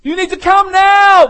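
loud, high desperate scream; a hammed-up panicked shout; screaming plea. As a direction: scream for help as if a brother died.

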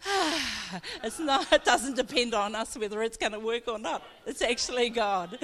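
a light mock sigh of relief turning into laughter; relieved exclamation. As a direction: let the weight drop off your shoulders. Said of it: "breathe now and go".

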